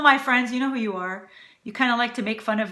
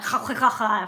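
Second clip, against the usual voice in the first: exaggerated throat-scraping kh sounds; mock guttural noises. Shown as a demonstration. Cook demonstrating what isn't needed, mock guttural. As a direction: hawk out a string of khs. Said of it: "do any guttural".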